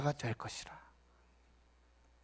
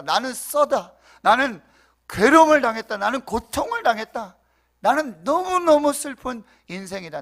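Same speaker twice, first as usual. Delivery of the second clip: raised, forceful lament; pitched-up, emphatic grieving declaration; bitter Naomi; 4 semitones higher, 6 dB louder than usual. Acted. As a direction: act out Naomi's bitter plea. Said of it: "나오미가 이렇게 말하고 있어요".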